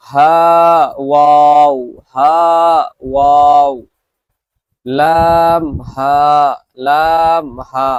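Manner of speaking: each letter name drawn out slowly and loud; letter drill. Shown as a demonstration. Teacher models letter pairs for students to repeat, drawn out. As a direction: sing out জিম and দাল long and clear. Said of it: "আমি দুবার পড়ব".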